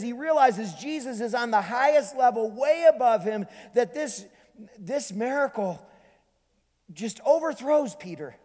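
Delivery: high, loud preaching at full force; impassioned preaching. Real, not acted. Preacher tells of Peter's awe, impassioned preaching. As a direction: drive it home to the congregation.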